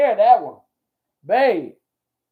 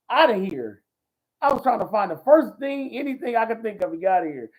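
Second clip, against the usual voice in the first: ordinary talk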